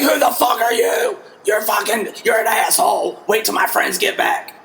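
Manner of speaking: high, shouted furious comeback; angry shout. Retold or imitated. Crossfit woman yelling at the bartender, high angry shout. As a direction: yell at him like an offended stranger. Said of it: "She's like".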